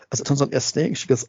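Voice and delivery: ordinary delivery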